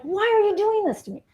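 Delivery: put-on high pleading child voice; distressed kid.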